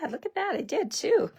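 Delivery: pleased squeal, pitch jumping up; delighted it worked, high and bright; excited. Real, not acted. Crafter spotting both watering cans cut, excited.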